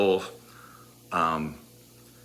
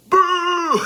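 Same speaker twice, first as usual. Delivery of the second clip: long, very high, loud boo; mock crowd booing; 6 dB louder than usual. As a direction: imitate an angry crowd booing. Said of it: "And the crowd".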